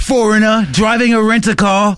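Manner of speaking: put-on British tourist; mock British accent. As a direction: explain it in a hammed-up British accent. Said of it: "old guy with a British accent".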